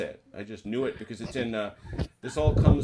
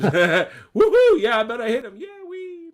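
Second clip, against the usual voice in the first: gleeful cheer; 15 dB louder, slower than usual. Real, not acted. Cheering a wild shot.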